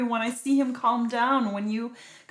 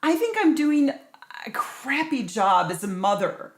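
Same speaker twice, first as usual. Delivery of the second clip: loud, higher overwhelmed anger; angry and overwhelmed, raised voice; 6 semitones higher, 5 dB louder than usual. Acted. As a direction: vent it all out at your husband. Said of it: "I am really angry".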